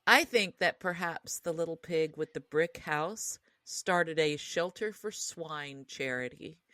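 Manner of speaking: ordinary talk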